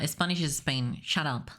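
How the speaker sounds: ordinary delivery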